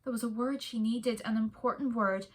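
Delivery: usual speaking voice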